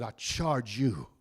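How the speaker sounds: dying Paul. Retold or imitated. Dying Paul voiced, low slow soft.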